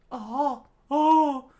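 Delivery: high, rising into a loud oh; excited character. Acted.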